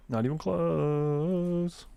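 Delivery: "Not even close" stretched very slowly, each word drawn out; slow emphatic repeat. Real, not acted. Complaining about the fifty missions, dragging out "Not even close". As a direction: drag out "Not even close" to drive it home.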